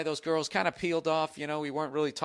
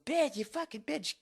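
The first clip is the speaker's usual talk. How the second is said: mock furious cursing; 12 semitones higher, 4 dB quieter than usual. Woman punching him in the side, mock furious. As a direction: mimic her tirade in a squeaky high voice.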